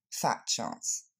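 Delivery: dry whispered "Fat chance", falling at the end; dismissive throwaway, stress on "Fat"; low whispered sarcasm, dropping on "chance"; ironic. Shown as a demonstration. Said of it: "une tournure largement ironique".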